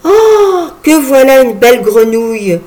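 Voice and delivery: high, bright cry of delight with a laugh; laughing exclamation. Acted. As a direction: exclaim it, laughing at the big frog. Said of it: "Puy Percé s'écria".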